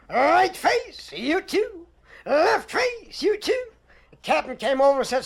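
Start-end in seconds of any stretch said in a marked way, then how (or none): 0.1-3.7 higher, clipped parade-ground orders; drill sergeant command. Retold or imitated. Sergeant drilling his squad, a higher parade-ground call. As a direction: bark the drill calls at the squad.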